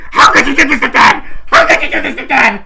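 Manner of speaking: very loud, high screaming; full-volume mock hysterics; imitated freak-out. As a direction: shriek it like a hysterical daughter. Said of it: "just freaks the fuck out".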